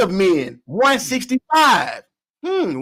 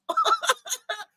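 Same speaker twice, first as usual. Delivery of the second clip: laughter; 8 dB quieter than usual. Real, not acted. Laughing at photo.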